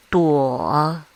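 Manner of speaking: Duo dipping low, then rising; third tone. Shown as a demonstration. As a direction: drop Duo low and lift it. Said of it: "this is how we mark the tones".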